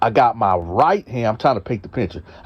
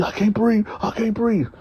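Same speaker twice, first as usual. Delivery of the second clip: choked plea; 9 semitones higher than usual. Retold or imitated. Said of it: "so he".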